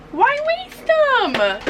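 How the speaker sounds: high, slow playful question. Acted. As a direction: stretch it out, teasing.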